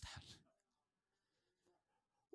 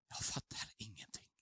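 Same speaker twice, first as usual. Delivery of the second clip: breathy retold whisper, much softer; 5 semitones lower, 8 dB quieter than usual. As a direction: hush it as if lying weak in bed. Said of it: "Och jag viskar".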